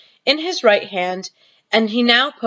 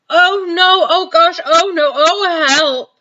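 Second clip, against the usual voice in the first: high, loud, slow cries of disgust; disgusted panic; 9 semitones higher, 6 dB louder, slower than usual. Acted. Sophie tasting the vile snozzcumber, panicking. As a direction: plead for help from the taste.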